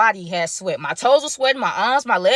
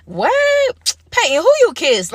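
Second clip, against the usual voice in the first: nosy friends gasping; 10 semitones higher, 5 dB louder, slower than usual. Retold or imitated. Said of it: "They like".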